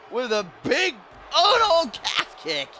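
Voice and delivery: high excited commentary yell; excited call. Real, not acted.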